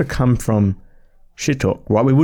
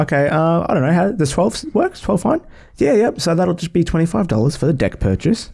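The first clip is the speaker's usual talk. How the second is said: rising hesitant guest; 6 semitones higher than usual. Retold or imitated. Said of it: "And they go".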